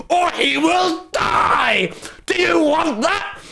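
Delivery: high, slow shriek of a fussy customer; mock outraged customer. Imitating the ice cream lady, shrieking at the server. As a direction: wail it like a demanding lady at the counter. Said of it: "this woman in front of us is like".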